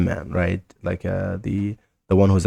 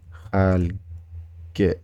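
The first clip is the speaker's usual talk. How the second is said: "خالقة" slowly split into khaal and ge; syllable by syllable; slower than usual. Shown as a demonstration. Teacher showing a common phrase, syllable by syllable. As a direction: stretch "خالقة", pausing before its last syllable.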